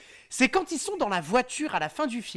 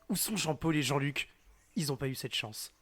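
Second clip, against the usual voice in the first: lower, softer mock solemn hero; mock bad acting; 8 semitones lower, 7 dB quieter than usual. Quoting Jean Reno's film line to friends, in a lower, softer mock solemn voice. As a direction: overplay the gloom like a bad actor. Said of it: "le très mauvais jeu d'acteur".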